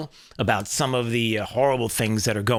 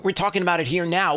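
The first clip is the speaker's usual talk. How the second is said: ordinary talk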